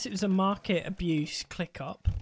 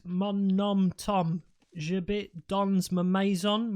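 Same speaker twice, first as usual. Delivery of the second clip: mock French accent; slower than usual. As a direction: fake French gibberish, partly slow. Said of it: "This is a French-only stream".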